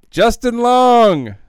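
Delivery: long, drawn-out high yell; loud, high, elongated outburst; triumphant shout. Real, not acted. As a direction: holler it out, stretching the last word. Blurts out the name being searched for.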